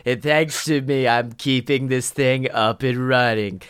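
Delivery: slow, put-on ride character voice; put-on mascot spiel, slowly; cheerful brain cell character. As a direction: play a chipper, self-satisfied brain cell, drawn out.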